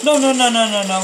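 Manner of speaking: loud, high panicked no's; panicked shout. Real, not acted. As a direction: yell it in alarm. Panicking in a game fight.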